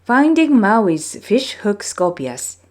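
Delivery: ordinary delivery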